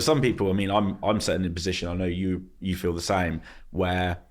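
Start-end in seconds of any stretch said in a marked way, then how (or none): none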